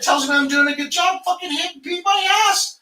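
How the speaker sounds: high, loud, fired-up yelling; excited shout. Real, not acted. Telling friend about a rival's threat, excited yell.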